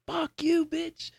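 very high, soft mock taunt; squeaky half-voiced imitation of a defiant fighter; imitating gassed fighter. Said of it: "but it was still saying".

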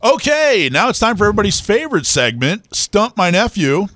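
upbeat segment announcement; segment announcer. Acted. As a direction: hype the segment name.

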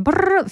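quick rolled "brrr" sound effect; imitated trill. Shown as a demonstration. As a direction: trill it like rewinding to an earlier day. Imitated noise between two days.

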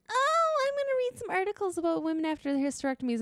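bright high self-quote; high chirpy quote. Retold or imitated. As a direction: chirp it cheerfully. Quoting own thought.

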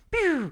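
high zippy pew; laser pew sound. Retold or imitated. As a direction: zap it like a laser. Ball flying off the tee.

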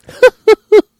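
very high, loud hooting laugh; whooping cartoonish giggle that stretches out; Ed Wynn. Podcaster doing a film comedian's laugh, high hooting impression. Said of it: "didn't do an Ed Wynn impression".

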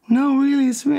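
high breathy celebrity voice on the phone; imitating Michael. Michael introducing himself on the phone, high imitation.

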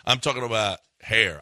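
ordinary talk

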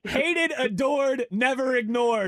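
loud, high Yinzer chant of the slogan; Pittsburgh accent. Acted. Host reprises the caller's slogan in a loud Pittsburgh accent. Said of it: "Just bring the accent with it".

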